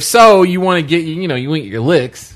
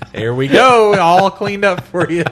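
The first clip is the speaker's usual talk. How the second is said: higher, slower chirpy service voice; cheery worker voice; 6 semitones higher, 5 dB louder, slower than usual. Retold or imitated. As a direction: play a breezy pizza cook. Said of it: "They just take a rag".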